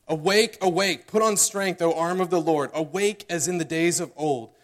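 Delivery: loud, declaiming reading of Isaiah; scripture reading. Acted. Preacher reading the prayer to the congregation, declaiming. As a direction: proclaim the prayer aloud, full voice.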